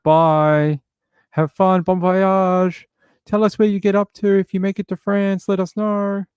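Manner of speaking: high, loud sing-song goodbye wave; mock cheery farewell. Safe crowd waving off an animal abuser, mock cheery. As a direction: wave him off in a sweet, taunting voice. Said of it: "just be like".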